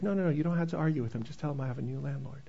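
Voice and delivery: fast, hushed, hurried to herself; rushed self-reminder. Retold or imitated. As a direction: talk yourself down quickly under your breath.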